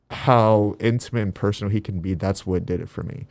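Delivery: usual speaking voice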